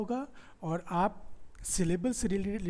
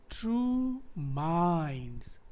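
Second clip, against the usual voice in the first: true minds very drawn out, weight landing on minds; stress on MINDS; 2 semitones lower than usual. Shown as a demonstration. Teacher letting students feel the stress, slow stressed demonstration. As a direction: stretch true minds slowly so minds carries the beat. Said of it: "आपको feel होगा stress कहाँ आ रहा है".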